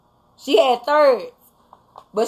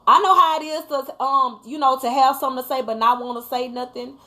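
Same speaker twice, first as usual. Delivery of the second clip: usual speaking voice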